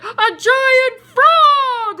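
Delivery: high, loud, drawn-out announcement; dramatic reveal. A narrator's reading. Storyteller reveals what came out of the river, dramatic. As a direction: stretch it out big for amazement. Reveals giant frog to listeners.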